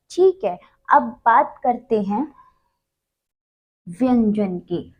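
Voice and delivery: ordinary delivery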